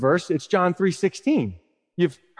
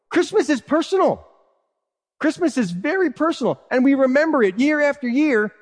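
ordinary talk, nothing marked

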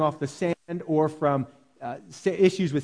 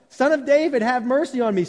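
high, louder cry for mercy; 7 semitones higher, 4 dB louder than usual. Retold or imitated. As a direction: shout over the crowd. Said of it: "he cried out all the more".

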